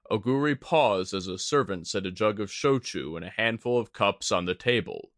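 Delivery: plain reading voice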